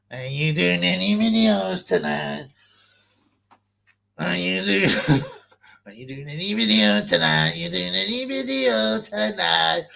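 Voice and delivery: slow, drawn-out put-on voice asking again and again; mocking imitation. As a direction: drag the question out like a pestering voice. Mimicking someone's repeated question.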